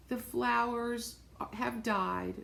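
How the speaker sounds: ordinary delivery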